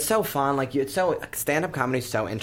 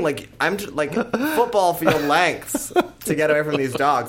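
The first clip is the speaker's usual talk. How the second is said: ordinary talk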